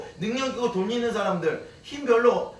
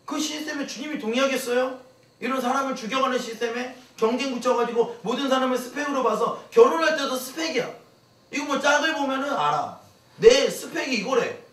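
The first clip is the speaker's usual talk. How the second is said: preaching at full force; 7 semitones higher, 6 dB louder than usual. Acted. Preaching against competition system.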